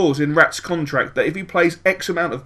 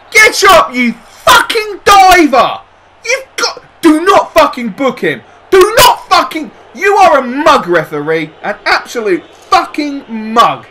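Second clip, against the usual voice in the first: much higher, loud furious yelling; furious shout; 9 semitones higher, 10 dB louder than usual. Real, not acted. Manager raging at a diving player and referee, furious shout. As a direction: rage at the ref.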